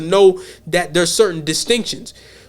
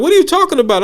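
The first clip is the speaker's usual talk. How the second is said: higher-pitched mock indignation; offended grandma; 7 semitones higher, much faster than usual. Grandma questioning her grandchild about collard greens, mock offended. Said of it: "She looked kinda offended".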